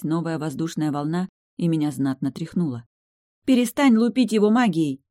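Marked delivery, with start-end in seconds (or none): 3.4-5.0 higher, louder urgent yell; yelled while hanging upside down; shouting. Acted. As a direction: shout at him to stop. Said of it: "выкрикнула я".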